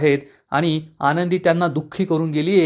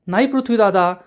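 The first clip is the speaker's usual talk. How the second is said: pitched higher in disbelief; shocked; 7 semitones higher than usual. Acted. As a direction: blurt it out, stunned. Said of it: "आरची आणि आरतीला आश्चर्याचा धक्काच बसतो". (धक्का बसलेला, उंच सुरातला आश्चर्याचा स्वर)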